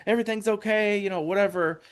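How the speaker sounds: hammed-up retelling of someone cooling things down; imitating a peacemaker. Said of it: "I remember Hero being there".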